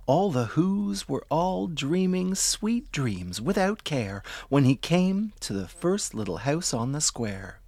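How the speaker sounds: ordinary delivery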